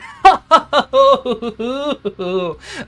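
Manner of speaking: high, loud gloating cackle; evil villain laugh. Acted. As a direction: laugh like a mad sorcerer.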